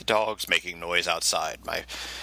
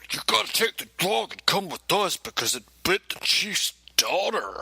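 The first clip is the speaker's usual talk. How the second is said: mocking the officer's voice; silly cop voice; 11 semitones higher than usual. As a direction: drag out the cop's question in a goofy voice. Cop orders him, voiced.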